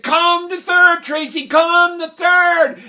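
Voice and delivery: loud high calling shout; coach calling. Retold or imitated. Third base coach calling Tracy, shouting. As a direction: call her in to third.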